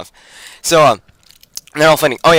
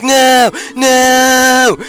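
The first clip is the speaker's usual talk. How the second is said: mock despair; 11 semitones higher, 5 dB louder than usual. Fellowship sees Gandalf fall.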